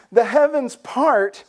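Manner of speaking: slow, high, loud marvel; awed. Real, not acted. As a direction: land it slowly, full of wonder. Preacher marvels at heavens opening.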